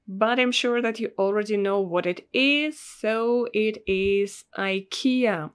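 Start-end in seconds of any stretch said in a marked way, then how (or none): 4.6-5.5 "ikea" opening with an eye sound; English pronunciation. Shown as a demonstration. Russian teacher gives the known brand word, English "ikea" as eye-KEE-uh. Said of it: "in English mostly it should be pronounced like".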